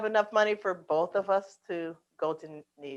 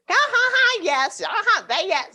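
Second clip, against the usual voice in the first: mock bird chatter; 9 semitones higher, 5 dB louder than usual. Imitating bird conversation.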